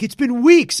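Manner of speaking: high, loud outburst; imitated exasperated yell; exasperated brother. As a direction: blurt it out in exasperated disbelief. Retelling brother's exasperated reply.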